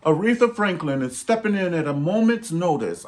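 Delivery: grand stage announcement, hammed up; mock formal announcer voice. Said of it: "Sting said".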